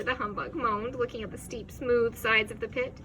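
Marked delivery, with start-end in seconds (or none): none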